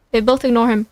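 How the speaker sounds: ordinary delivery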